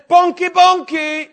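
high, loud, slow, laughing yell; yelling into an ear. Retold or imitated. Preacher yells into the pastor's ear from the pile, loud and laughing. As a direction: bellow it out while laughing. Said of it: "I was yelling in the pastor's ear".

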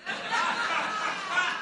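high squealing laugh at his own joke; a burst of high laughter; high giggle. Real, not acted. Preacher cracking up at his own pickup line, high squealing laugh.

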